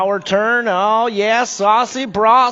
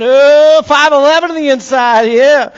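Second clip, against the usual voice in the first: excited call; 7 semitones higher, 8 dB louder than usual. Real, not acted. Close battle on the inside.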